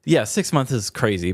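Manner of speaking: ordinary delivery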